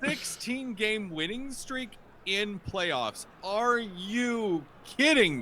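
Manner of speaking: slow, high, incredulous; astonished disbelief. Real, not acted. Co-host reacts to the winning streak stat, astonished. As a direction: stretch each word out in amazement.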